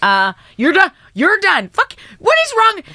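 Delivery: very high, very loud shriek; yelling. Retold or imitated. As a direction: scream it in frustration. Acted quarrel with partner.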